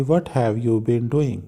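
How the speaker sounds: each word read out slowly and clearly; slow, clear teacher read-through; slow model reading. Shown as a demonstration. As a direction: read the question slowly for the class. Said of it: "ये हमने question बनाना है".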